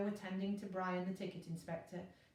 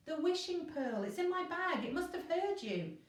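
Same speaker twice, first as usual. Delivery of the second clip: high, eager excited cry; cried out; 9 semitones higher than usual. A narrator's reading. Elliot explaining the wishing pearl to Virgo, excited. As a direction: blurt it out in a rush. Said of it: "cried Elliot".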